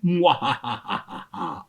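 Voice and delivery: slow theatrical villain cackle; drawn-out vampire laugh, low and slow; evil Count laugh. Retold or imitated. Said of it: "Count Dracula Sesame Street".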